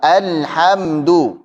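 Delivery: "Alhamdu" very slow, l and m held; parked lam. Shown as a demonstration. Teacher asks students to try, slow held "Alhamdu". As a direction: draw out "Alhamdu" without bounce. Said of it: "parkir dulu".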